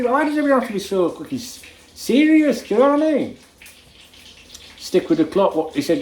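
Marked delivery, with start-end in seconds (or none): 0.0-3.4 high-pitched real exasperation; exasperated. Real, not acted. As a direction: plead at high pitch, fed up with seriousness. Exasperated rant about people being serious.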